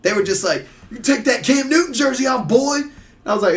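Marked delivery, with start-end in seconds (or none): 0.9-2.8 high-pitched mock heckle; imitated hostile fan. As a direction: imitate a rival fan picking a fight. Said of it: "if these dudes could get to me".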